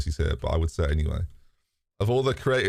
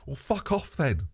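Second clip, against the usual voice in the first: mock dismissive retort; 6 semitones higher, 3 dB quieter than usual. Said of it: "what I want to reply to him is".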